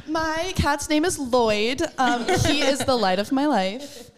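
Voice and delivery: slow, loud, high-pitched adoration; gushing proudly. Real, not acted. Player asked about her beloved cat, gushing proudly. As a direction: draw it out with delighted pride.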